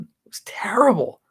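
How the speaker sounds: hushed, breathy whisper of disgust. Real, not acted. Repeating his verdict on the concert in a whisper.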